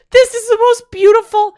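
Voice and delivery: high, loud tearful gush; overwhelmed gush. Retold or imitated. Reliving the birth scene, high loud gush. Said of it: "And I'm like".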